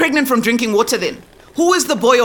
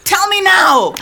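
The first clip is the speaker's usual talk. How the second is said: sharp, higher order; angry demand; 7 semitones higher, slower than usual. Acted. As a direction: insist right now. Mother demanding the boy's name.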